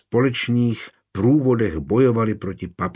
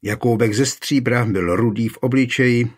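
ordinary delivery

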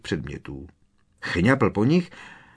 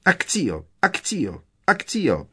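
each spell higher than the last; mother summons the sweets, climbing higher; repeated cries; 8 semitones higher, slower than usual. A narrator's reading. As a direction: shout the spell ever more urgently. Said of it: "vykřikovala znovu a znovu".

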